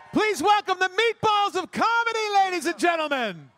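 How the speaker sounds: high, loud showman welcome; big shouted introduction. Real, not acted. Emcee announcing the comedy act to the crowd, shouted. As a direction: bring them on like a boxing announcer.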